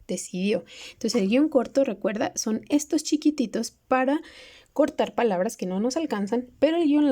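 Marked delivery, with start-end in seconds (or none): none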